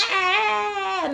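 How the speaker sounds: sudden high wail of crying. Retold or imitated. Retelling how she cried at his words, a high wail. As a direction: burst into a high mock sob.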